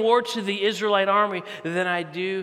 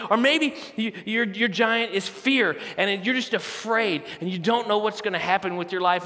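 usual speaking voice

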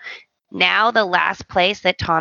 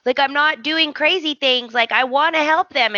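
higher, earnest pleading; pleading protest; 7 semitones higher than usual. Retold or imitated. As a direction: plead with him, pitched up. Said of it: "he's like".

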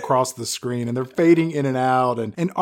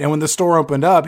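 ordinary delivery

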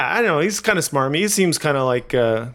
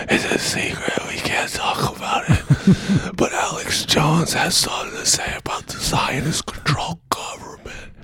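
secretive breathy whisper; ASMR whisper; 3 semitones higher than usual. Pretend. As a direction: hush it like a secret. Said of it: "This is Zionist A S M R".

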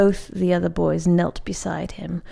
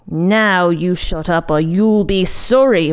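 louder, emphatic warning; threatening, breathing hard; 5 dB louder than usual. A narrator's reading. Monk threatens to shake Sho's branch, warning. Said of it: "breathing hard".

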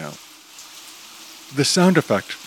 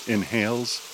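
ordinary delivery, nothing marked

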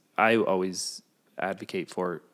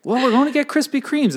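eager insist; 7 dB louder than usual. Pretend. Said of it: "And it's just like".

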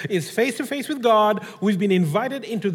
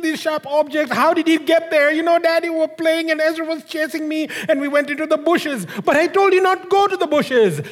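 put-on higher child voice explaining; a boy sniffling out his story; crying child; 6 semitones higher than usual. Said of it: "the boy is crying".

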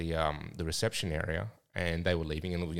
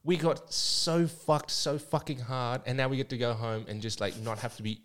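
put-on girl voice; 5 semitones higher than usual. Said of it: "Is this your girl voice?".

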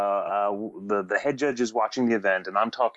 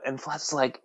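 ordinary delivery

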